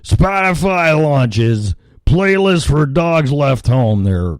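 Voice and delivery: normal talking voice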